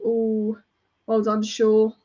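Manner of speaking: ordinary delivery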